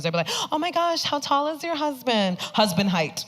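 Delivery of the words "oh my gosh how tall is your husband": high, gushing busybody imitation; nosy questioner. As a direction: coo an intrusive question. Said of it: "People get intrusive with their questions".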